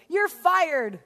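loud, high You're fired; mock firing. Wife firing husband as source of life, hammed-up loud. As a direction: fire him with gusto. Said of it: "I'm like".

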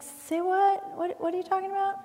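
comically puzzled mock reply; confused. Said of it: "Nicodemus is totally confused".

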